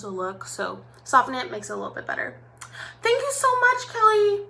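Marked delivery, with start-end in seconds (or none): 3.0-4.4 much higher bright thank-you; excited thanks. Real, not acted. Vlogger to viewer Kelly, high excited thanks.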